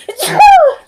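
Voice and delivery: sudden shrill laugh; loud high laugh burst. Real, not acted. Burst after sneeze warning.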